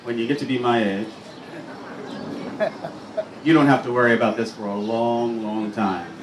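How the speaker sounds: low, quick confiding aside. Real, not acted. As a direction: lean in with a low-pitched aside.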